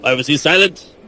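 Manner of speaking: ordinary delivery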